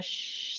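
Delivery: soft slow shh like a fan; soft fan whoosh. Retold or imitated. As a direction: breathe out a fan's hush. Imitating a fan sound.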